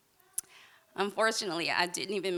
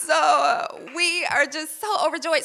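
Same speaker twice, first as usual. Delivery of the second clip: laughing; 6 semitones higher, 6 dB louder than usual. Real, not acted. Laughing through pregnancy news.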